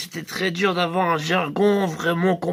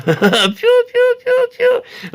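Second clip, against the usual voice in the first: slow then high piou chirps; high loud-ish bird chirps; sardine bird call; 4 dB louder, much slower than usual. Shown as a demonstration. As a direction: chirp it like a flying fish. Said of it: "quand elle vole sous l'eau".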